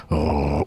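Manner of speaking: low rolled growl at back of tongue; imitated dog snarl. Shown as a demonstration. Streamer showing how dog mimicry became Chewie, low snarl. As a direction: roll the R low in the throat. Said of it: "mimic their sound".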